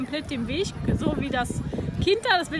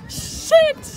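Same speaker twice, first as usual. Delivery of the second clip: frustrated yell; 10 semitones higher, 7 dB louder than usual. Real, not acted. Loses rock paper scissors.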